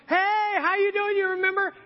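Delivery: high, loud hail to an acquaintance; calling out. Retold or imitated. Greeting a neighbor not seen in years, calling out.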